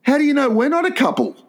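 high, fast mock protest; high indignant question. Voter challenging the poll worker, high and fast. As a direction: fire it back high and quick, affronted. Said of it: "I said to the woman".

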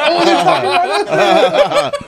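high, slow, laughing stoner voice; stoned listener voice. Retold or imitated. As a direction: drawl it like a giggly stoner. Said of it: "They're like".

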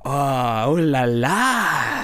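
very drawn-out French ooh-la-la; French accent. Acted. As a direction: stretch it out like a comic French lover. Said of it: "sexy about the French accent".

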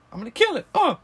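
loud, high mock-menacing grunts; exaggerated attack voice with grunts; hammed-up killer vet. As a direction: play a comically eager killer, punched out. Acting out the vet doctor.

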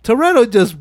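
incredulous rant. Retold or imitated. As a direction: blast him for calling it no handouts. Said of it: "I'm like".

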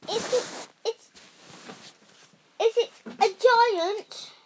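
high, stammering, loud fear; terrified mother. Acted. Mother panicking as the giant comes down. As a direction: stumble in fright.

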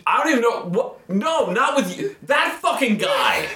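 high-pitched, flustered protest; exasperated outrage. Retold or imitated. Flustered angry stranger answers the friend.

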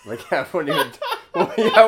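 loud, high, helpless laughing through the words; cracking up mid-sentence, pitch and volume soaring. Real, not acted. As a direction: barely get it out for laughing. Laughing at own temper story.

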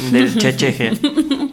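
laughing. Real, not acted. Joking about the made-up club.

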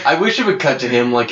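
ordinary talk

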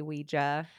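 Ouija said as WEE-juh, quoting the co-host; wee-juh pronunciation. Retold or imitated. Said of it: "I've heard you say".

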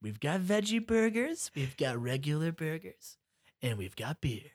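hammy TV host listing prizes slowly; weird host voice. Retold or imitated. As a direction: tease out the menu like a game-show host. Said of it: "why are you talking like this".

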